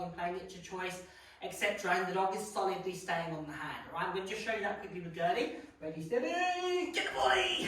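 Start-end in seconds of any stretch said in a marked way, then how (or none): 5.8-7.6 excited dog cue. Real, not acted. Trainer cueing her dog, excited. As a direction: pump the dog up as you cue.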